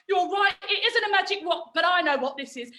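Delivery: ordinary talk, nothing marked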